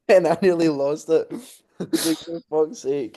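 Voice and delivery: high laughing, barely holding it in; laughing through the words; cracking up mid-story. Real, not acted. Laughing fit retelling.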